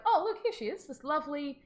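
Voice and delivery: high, sing-out presentation; feigned innocence. Acted. Daughter presenting victim to mother.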